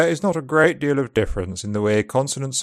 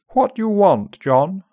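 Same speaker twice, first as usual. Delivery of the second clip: upper RP accent; 7 semitones higher than usual. Shown as a demonstration. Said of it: "Upper R P is more open".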